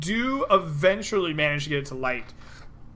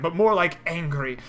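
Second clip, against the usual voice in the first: usual speaking voice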